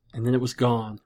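ordinary delivery

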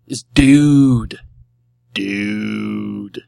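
long, stretched-out "dude"; slow, showy word reveal; drawn-out reveal. Pretend. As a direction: savor the word, holding the vowel.

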